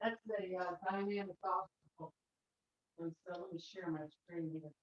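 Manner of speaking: distant, off-mic voice; speaking from across the room; from another room. Real, not acted. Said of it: "We can't hear you".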